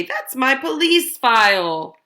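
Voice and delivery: usual speaking voice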